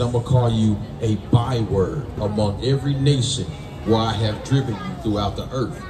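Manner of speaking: ordinary talk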